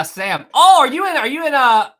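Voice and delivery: ordinary talk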